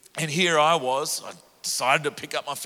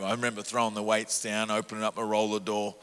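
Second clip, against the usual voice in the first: ordinary talk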